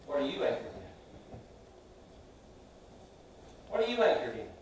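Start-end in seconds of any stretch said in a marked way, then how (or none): 0.1-1.4 whispered question. Acted. Question repeated to congregation.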